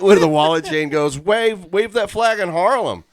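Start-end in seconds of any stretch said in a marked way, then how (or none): 1.2-3.0 high-pitched imitation of a kid's yell; teenage heckler's holler, retold. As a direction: call it out high, acting out the heckle. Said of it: "and I, I yelled".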